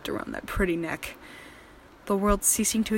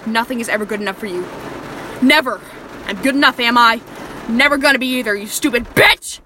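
higher and louder furious yelling; screaming rage; 3 semitones higher, 8 dB louder than usual. Acted. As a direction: let the rage explode. Captor explodes at captive.

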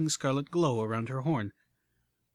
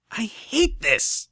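slow, higher, louder shout; frustrated outburst; 9 semitones higher, 6 dB louder, much slower than usual. Acted. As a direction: stamp and vent it. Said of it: "then stomped".

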